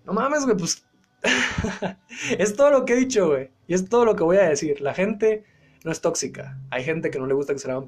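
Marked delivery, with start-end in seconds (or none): 1.2-1.9 quick amused laugh; short laugh. Real, not acted. Laughing at himself.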